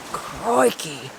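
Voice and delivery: slow, hammed-up fake Australian accent; lurking near hot springs, a mock Australian Steve Irwin drawl; cheesy Steve Irwin accent. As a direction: ham up a wildlife host's Australian twang. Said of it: "cheesy fake Australian Steve Irwin accent".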